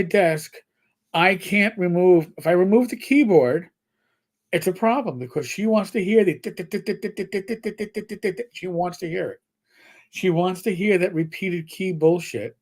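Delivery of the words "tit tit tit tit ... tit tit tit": rapid, higher, softer tit-tit-tit; imitated key clicks. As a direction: mimic repeated keyboard taps, evenly. Imitates keyboard clicks the cat likes.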